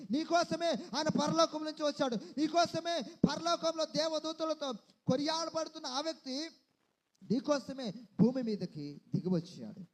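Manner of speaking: loud, driving sermon cadence; fervent preaching. Acted. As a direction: preach it out to the whole hall.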